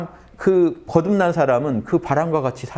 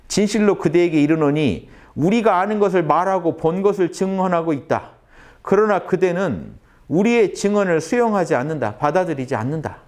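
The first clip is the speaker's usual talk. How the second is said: scripture reading; 5 semitones higher than usual. Acted. Reads Jesus' words from scripture.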